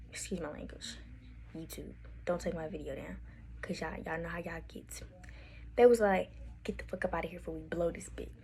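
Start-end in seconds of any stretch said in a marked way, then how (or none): 0.1-5.0 hushed, confidential whisper; whispered aside. Real, not acted. Hushed aside to YouTube about swearing. 6.6-8.3 retold threat in a quick whisper; whispered mock threat. Retold threat with swearing, kept hushed.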